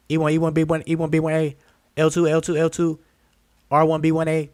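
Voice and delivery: high auctioneer chant. Retold or imitated. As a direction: rattle off lot numbers like an auctioneer. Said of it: "car auction, auctioneers".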